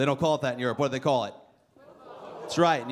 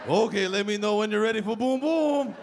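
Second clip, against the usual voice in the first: put-on Russian stripper accent; 5 semitones higher than usual. Russian stripper offering a lap dance, thick accent. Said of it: "Russian accent".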